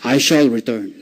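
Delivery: hammed-up solemn promise; dramatic parting vow. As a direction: vow it low as you turn away. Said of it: "Sasabihin mo, pag talikod".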